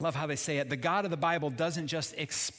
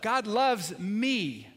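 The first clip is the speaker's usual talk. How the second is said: slow and louder, with conviction; said again, louder; 4 semitones higher, 5 dB louder, much slower than usual. Shown as a demonstration. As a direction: lean into "God loves me." slower and louder. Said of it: "Say it again".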